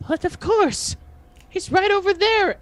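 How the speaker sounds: very high, loud creature voice; high-pitched kobold. Acted. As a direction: pipe up as an eager little kobold. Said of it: "very high-pitched voice goes".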